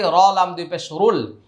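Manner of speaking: ordinary talk, nothing marked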